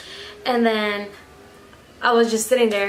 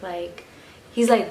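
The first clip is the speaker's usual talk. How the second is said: ordinary delivery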